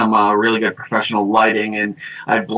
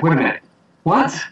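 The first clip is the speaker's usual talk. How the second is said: mock disbelief; 6 semitones higher than usual. Reacting to the actors' interview, mock astonishment. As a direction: pitch leaping up in surprise.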